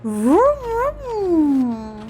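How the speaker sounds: slow, high drawn-out vroom noise; engine vroom. Pretend. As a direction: hum like a motorbike engine. Imitating engine on a bike.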